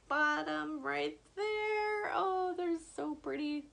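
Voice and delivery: high, cooing admiration; gushing. Real, not acted. Vlogger showing favorite palette colors to viewers, gushing. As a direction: coo over it, pitched well up.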